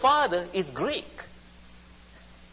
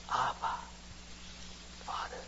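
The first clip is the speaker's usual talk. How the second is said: very soft, tender address with a long pause; hushed intimate cry; 14 dB quieter than usual. Retold or imitated. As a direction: murmur it like a private prayer.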